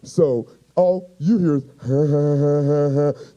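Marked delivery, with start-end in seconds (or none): 1.8-3.3 dopey cartoon sloth chuckle, repeated pulses; imitated sloth-like laugh. Comedian mocking his buddy Cam, goofy sloth laugh. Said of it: "sound like the sloth from Ice Age".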